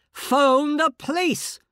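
loud, high, slow insistent bark; barking bullying order again. Acted. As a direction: hammer the order home. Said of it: "this awful bullying voice, barking orders".

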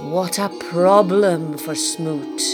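usual speaking voice

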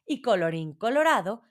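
usual speaking voice

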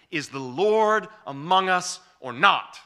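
loud, slow, sneering demand; mocking the people's complaint; grumbling challenge. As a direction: throw the doubt back at God, slowly. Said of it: "That's their attitude".

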